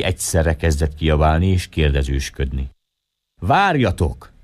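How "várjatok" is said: high call for everyone to wait; cry out. Acted. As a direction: raise the voice high to stop the crowd. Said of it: "kiáltott az európai". (magas hangon felkiáltva)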